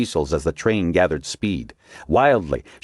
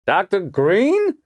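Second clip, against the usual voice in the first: slow, stretched drawl, pitched up; 4 dB louder, much slower than usual. A narrator's reading. Pendergast recognizes Margot in the tunnel, a slow, questioning drawl. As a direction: draw the name out slowly in a questioning tone. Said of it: "came the familiar drawl".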